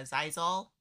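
ordinary talk, nothing marked

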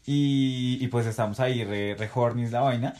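ordinary delivery

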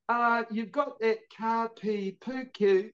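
lightly voiced tentative stranger; unsure random caller. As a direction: sound out the unfamiliar name slowly. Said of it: "these random people would be calling me".

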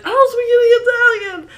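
very high, loud mock whine; imitating Omar's protest. Host imitating the third guy's protest, high comic wail. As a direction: squeal the protest in a hammed-up imitation. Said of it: "who's like".

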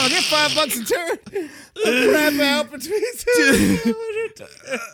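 high mock Maine-woman voice, slowed, laughing through it; mimicking the turd lady. Friend mimics the video's turd seller in a high, laughing, mocking voice. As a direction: parrot the seller's pitch in a squeaky put-on voice. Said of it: "mimicking the turd seller on video".

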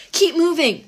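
loud yelled order; yelling. A narrator's reading. Zoe shouting to the others while fleeing, loud yell. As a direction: shout it across the road. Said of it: "Zoe yelled".

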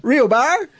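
put-on broad Aussie accent on real barra. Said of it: "When you say it in an Aussie accent".

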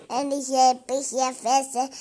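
loom over her like the hungry wolf; big bad wolf. Child plays the wolf.

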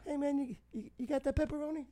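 high, soft, furtive voice; hushed imitation. Customer discreetly asking about pepperoni, high hushed voice. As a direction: play a sneaky, hushed high-pitched customer. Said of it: "like, whisper".

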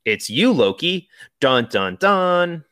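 big dramatic reveal, sung sting. Retold or imitated. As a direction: announce it like a twist. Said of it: "Mister Mobius goes".

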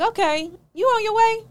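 mock-cheerful check-in; sarcastic. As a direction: drip with sarcasm. Sarcastic quote to renter.

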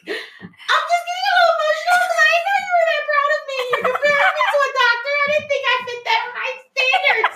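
high, loud, teary voice breaking into laughter; quoting herself tearfully to her mom, high and loud, laughing through crying; crying on the phone. Retold or imitated. Retelling her tearful call with mom, teary and laughing. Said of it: "bawling on the phone".

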